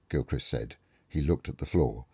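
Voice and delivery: ordinary delivery, nothing marked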